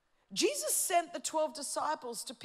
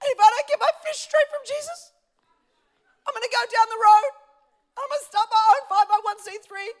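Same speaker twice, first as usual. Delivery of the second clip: higher, louder, whiny mock congregant; self-important complainer; 9 semitones higher, 15 dB louder than usual. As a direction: whine like a proud member who won't be served. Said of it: "you've got the people going".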